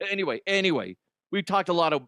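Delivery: usual speaking voice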